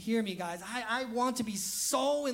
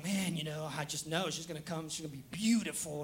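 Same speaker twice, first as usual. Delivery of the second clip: smitten guy; 5 semitones lower, 4 dB quieter than usual. Retold or imitated. Pastor voices smitten men.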